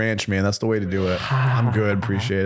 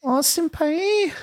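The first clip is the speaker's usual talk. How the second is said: mock anime girl. Mocking his friend with a high cooing anime girl. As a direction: squeal it like a lovestruck cartoon girl. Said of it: "I'm allowed to do that accent".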